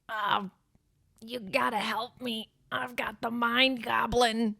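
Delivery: slow, drawn-out villain in distress; hammed-up Green Goblin plea; Willem Dafoe. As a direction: plead slowly in an altered character voice. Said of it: "That's my best Willem Dafoe impression".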